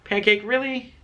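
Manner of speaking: high exasperated question to cat; mock scolding. Real, not acted. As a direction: tease the cat for misbehaving.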